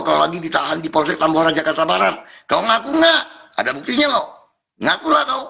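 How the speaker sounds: high, goading voice, daring him to confess; taunting demand. Real, not acted. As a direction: needle him upward and insistently. Pressing the scammer to answer. (menantang dengan suara tinggi)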